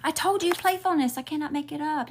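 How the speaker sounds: thrilled I-told-you squeal; high, loud, delighted I told you; excited high exclaim. Real, not acted. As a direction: pitch jumps way up in delight. Excited at card confirming.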